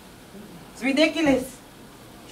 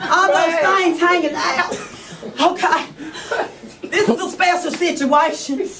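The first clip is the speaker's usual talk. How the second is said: mock preacher. Preacher rebuking the sinner, hammed-up sermon. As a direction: rebuke her from the pulpit, hammed up.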